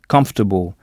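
comfortable with the OR almost silent; OR barely audible. Shown as a demonstration. Teacher showing the silent OR in comfortable. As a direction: let the middle of comfortable vanish. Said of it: "O R est à peine audible".

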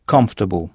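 "Comfortable," said KUMF-tuh-bl, stress on the first syllable; English pronunciation. Shown as a demonstration. Teacher shows the English word to French learners. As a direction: say "Comfortable," with the O and R nearly silent. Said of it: "la première syllabe qu'il faut frapper".

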